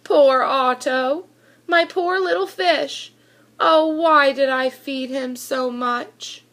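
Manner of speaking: ordinary delivery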